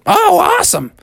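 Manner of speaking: put-on high, delighted kid voice. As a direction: cheer it as excited kids. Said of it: "they all said".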